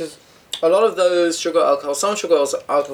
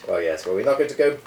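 ordinary talk

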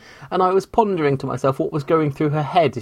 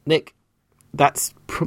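ordinary talk

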